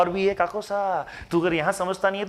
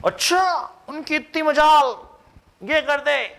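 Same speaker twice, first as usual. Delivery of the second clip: much higher, slow, indignant; mock outrage; 9 semitones higher than usual. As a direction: play an offended boss. Minister outraged at the court. (नाटकीय गुस्सा, बहुत ऊँची आवाज़ में)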